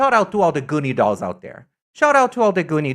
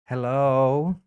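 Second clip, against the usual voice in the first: normal talking voice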